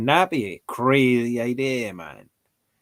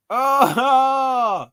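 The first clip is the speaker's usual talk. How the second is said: loud, high drawn-out "oh"; long high howl of disbelief; mock shocked wail; 13 semitones higher, 7 dB louder than usual. Reacting to the wrong answer.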